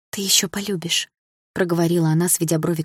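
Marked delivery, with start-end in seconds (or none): 0.1-1.1 whisper. Acted. Mother to heartbroken daughter, whispering. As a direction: hush it, barely voiced.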